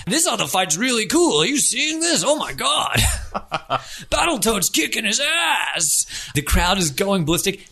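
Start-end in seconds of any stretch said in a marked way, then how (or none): 0.1-6.1 excited onlookers. Acted. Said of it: "But then they stop".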